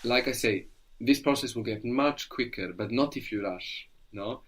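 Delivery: ordinary talk, nothing marked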